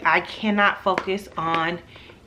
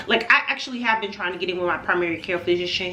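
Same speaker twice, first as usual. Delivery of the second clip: ordinary talk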